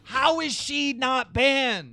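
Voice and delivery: slow, high mock disbelief; incredulous. Real, not acted. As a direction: stretch it out, can't believe it. Incredulous question.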